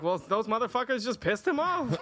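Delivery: lightly acted inner voice shrugging off murder; mock sympathetic killer logic. Imagined serial killer sympathy.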